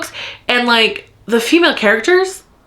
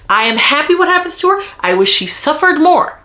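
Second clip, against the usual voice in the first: high, fast and heated; furious rant; 6 semitones higher than usual. Real, not acted. Vlogger venting fury at a book character, heated and high. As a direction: spit it out in outrage. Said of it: "I'm so mad right now".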